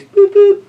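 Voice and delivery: squeaky boop boop horn; high train toot. Acted.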